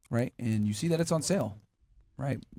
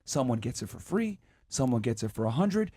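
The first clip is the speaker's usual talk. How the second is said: ordinary talk, nothing marked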